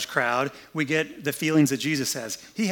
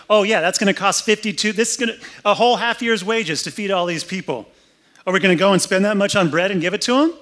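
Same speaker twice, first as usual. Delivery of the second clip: higher, louder mocking sarcasm, quickening; sarcastic tone; 5 semitones higher, 5 dB louder than usual. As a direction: scoff at the whole idea. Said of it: "You hear the tone like".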